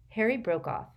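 usual speaking voice

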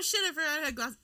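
normal talking voice

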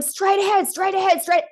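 high, loud, repeated insisting; insistent directions. Retold or imitated. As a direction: mimic someone urgently giving directions. Husband's insistent directions acted out.

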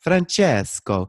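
hammed-up Italian accent, slow and loud; playful mock-Italian call; terrible Italian accent. Shown as a demonstration. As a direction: draw out the name in a bad Italian accent. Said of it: "when I would do my Italian accent".

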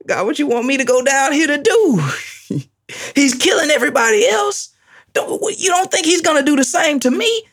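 high, fast, laughing protest to God; high fast voice, half laughing in disbelief; incredulous Ananias. Acted. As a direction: play Ananias pleading he'll be killed. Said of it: "acting Ananias protesting to God".

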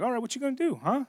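high-pitched calling of a bluff; mock challenge. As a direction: toss it out as a teasing dare. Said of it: "I stay away from those kids. I'm like".

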